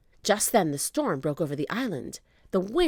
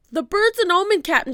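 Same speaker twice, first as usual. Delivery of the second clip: high, loud frightened pirate; loud high pirate warning; shouted warning; 13 semitones higher, 8 dB louder, faster than usual. A narrator's reading. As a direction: shout the omen to the captain. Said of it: "shouted Stinky".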